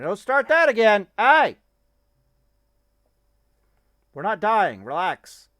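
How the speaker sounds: yelling at the dog to stop and relax; scolding the dog. Real, not acted. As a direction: snap at the dog to knock it off. Dog starts barking again.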